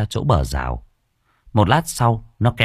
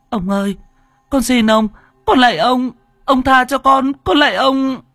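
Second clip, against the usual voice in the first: put-on much higher, pleading little girl; frightened girl; 5 dB louder than usual. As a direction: beg for mercy like a scared child. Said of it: "tiếng đứa bé gái hoảng hốt". (bé gái hoảng hốt van xin, giọng cao)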